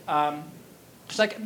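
ordinary delivery

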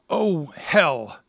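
groaned, sinking dismay. A narrator's reading. Would-be Romeo hearing the husband arrive, groaning. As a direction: groan it like a man who is trapped. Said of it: "groaned poor Romeo".

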